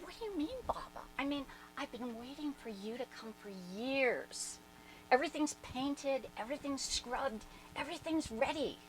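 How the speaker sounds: earnest protest. Retold or imitated. Reassuring Baba about his visit.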